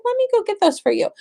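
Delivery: high, fast sing-song; sweet eager offer. Retold or imitated. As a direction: offer it like a doting mom.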